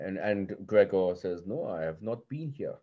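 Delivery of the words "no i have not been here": a lightly put-on accent, much softer; a hint of an accent, softly; Gregor's accent. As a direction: say it in Gregor's accent, quiet and understated. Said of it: "That's my accent, by the way".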